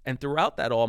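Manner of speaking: normal talking voice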